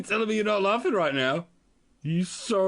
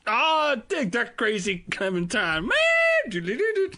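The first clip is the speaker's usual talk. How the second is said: mock hip CEO; 7 semitones higher, much slower than usual. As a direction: play the CEO as a groovy cat, shouting it. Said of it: "The C E O goes".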